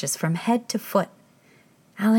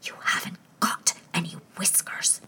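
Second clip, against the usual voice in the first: much softer whisper; 6 dB quieter than usual. Acted. Queen answering the King, whispering. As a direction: flatly correct him under breath. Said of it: "in a frightened whisper".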